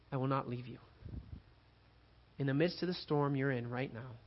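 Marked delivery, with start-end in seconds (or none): 0.1-0.8 soft promise. Acted. God's promise, spoken softly.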